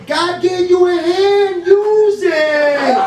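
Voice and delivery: high, drawn-out exhorting yell; ranting shout. Acted. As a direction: preach it loudly at the crowd, stretching the words. Comic rant urging the crowd.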